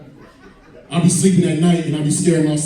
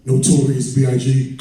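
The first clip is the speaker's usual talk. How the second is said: usual speaking voice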